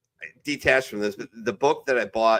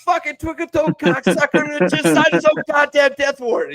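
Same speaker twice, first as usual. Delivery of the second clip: much higher and louder barking drill sergeant; furious drill sergeant tirade, pitched way up; drill instructor yell; 8 dB louder than usual. Retold or imitated. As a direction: scream it like a boot camp drill instructor. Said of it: "that's probably my favorite one".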